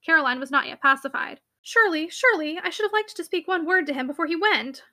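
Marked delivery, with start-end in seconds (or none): none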